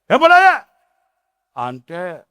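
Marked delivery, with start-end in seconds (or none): 0.1-0.5 sudden high, loud scream; much higher and louder yell; shout. Retold or imitated. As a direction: shriek it at the sons. Mother shouting at her sons.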